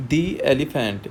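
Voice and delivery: "the elephant" में "the" को "दी" बोलकर सीधे elephant में मिलाना; "दी" को elephant से बिना रुके जोड़ो; smooth "dee". Shown as a demonstration. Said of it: "दी और एली को ... मर्ज कर देते हैं". ("the elephant" में "दी" और "एली" जुड़े हुए)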